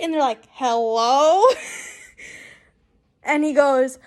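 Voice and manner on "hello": drawn-out, questioning hello; creepy caller greeting. Retold or imitated. Unknown caller answering her on the phone, mildly creepy. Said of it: "don't act creepy".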